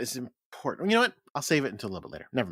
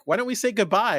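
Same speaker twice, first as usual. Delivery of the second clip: higher, louder little-one voice; 8 semitones higher, 5 dB louder than usual. Retold or imitated. Little one asks the others about goodbyes, higher mock child voice. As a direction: pitch it up as a small one asking. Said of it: "the little one is like".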